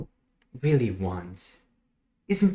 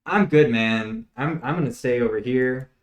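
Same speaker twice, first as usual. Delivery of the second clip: group member declining; 5 dB louder than usual. Acted. The group turns down the host's offer, loud and casual. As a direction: wave off the offer, louder and unhurried.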